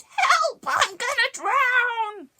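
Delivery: very high, loud scream; screaming witch. A narrator's reading. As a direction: shriek it as she sinks.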